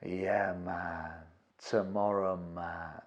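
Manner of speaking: very soft, low, lazy stoned voice; half-asleep, can't be bothered; stoned tenant. Retold or imitated. Stoned tenant promising the landlord, low and sleepy. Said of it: "They are stoned".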